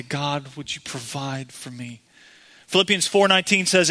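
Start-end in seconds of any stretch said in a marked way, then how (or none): 0.0-2.0 hushed plea to God, fading; quiet pleading prayer; softer prayer. Acted. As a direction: pray it softly, trailing away. Voiced congregant prayer.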